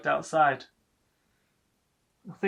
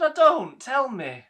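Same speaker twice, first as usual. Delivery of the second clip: a high, louder mother snapping back; hint of a prickly old mother, pitched up; mother's voice; 9 semitones higher, 7 dB louder than usual. Mother voiced, bristling at son.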